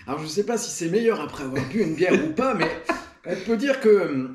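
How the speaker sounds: ordinary talk, nothing marked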